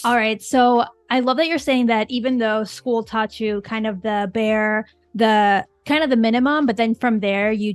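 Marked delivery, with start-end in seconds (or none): none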